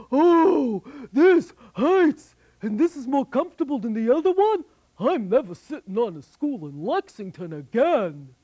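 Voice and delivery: hurt giant complaining fast; pained giant. Acted. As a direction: cry out as the quills stick him. Giant pricked by quills.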